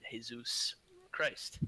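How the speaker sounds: slow, breathy whispered Jesus Christ; mock whisper. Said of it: "I'm just messing around".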